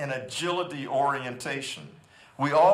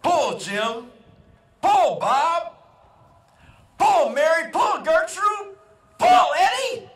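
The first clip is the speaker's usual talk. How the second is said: farmer's loud, high holler to his mule, rising call by call; full-voiced yelled commands, climbing in pitch; yelling out; 12 semitones higher, 7 dB louder, slower than usual. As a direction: holler each command higher, building to a shout. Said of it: "And he yells out".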